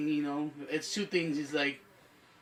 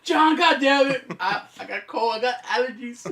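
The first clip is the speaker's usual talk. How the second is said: loud, higher mock excuse from a man hiding tears; mock crying tough guy; 4 semitones higher, 7 dB louder than usual. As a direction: ham up a macho guy denying he cries. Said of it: "all you hear is like".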